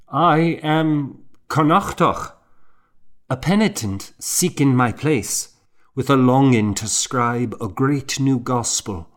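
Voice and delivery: a penitent explaining himself to staring monks; earnest young monk. A narrator's reading. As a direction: announce yourself hopefully to the elders.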